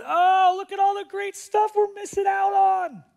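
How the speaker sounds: mock a nostalgic complainer; whiny longing. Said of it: "looking back to Egypt and going".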